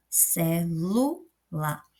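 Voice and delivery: "celular" very slowly in three pieces, ce... LU... lar; stress on middle syllable. Shown as a demonstration. Teacher isolates the tonic syllable, "celular" split around stressed "lu". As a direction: pause between each syllable of "celular", leaning on "lu". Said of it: "eu coloco mais intensidade na segunda sílaba".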